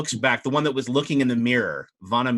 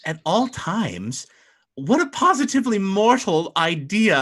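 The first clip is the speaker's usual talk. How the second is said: higher, amused and haughty; haughty mermaid; 6 semitones higher than usual. Acted. Madrigal scoffs at her betrothed's wish to stay the same, higher. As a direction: scoff at his mortal ideas. Mermaid bride scorns mortal idea.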